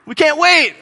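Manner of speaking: loud high mock cheer; crowd cheer. Crowd cheering back at the opener. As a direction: shout like an eager crowd.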